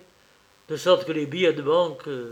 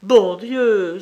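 high, loud outraged exclamation; indignant cry; 6 semitones higher, 9 dB louder than usual. A narrator's reading. Maid cries out at the baroness's suspicion. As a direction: burst out in shock. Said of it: "s'écria Hermine".

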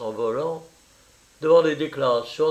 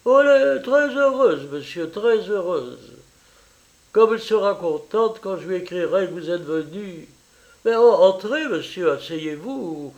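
higher, effusive Italian mother welcoming a guest; eager landlady mother; 6 semitones higher, 4 dB louder than usual. Acted. Mother welcoming her daughter's French lover, eager and high. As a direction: gush over the visitor, pitched up. Narrator voices Madame Rondoli.

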